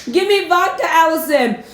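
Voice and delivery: high, squeaky mock plea with a giggle; begging fan voice. Playing a viewer begging host for vodka, squeaky mock plea. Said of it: "you gotta tell me in the group".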